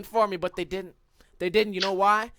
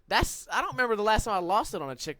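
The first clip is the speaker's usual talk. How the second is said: usual speaking voice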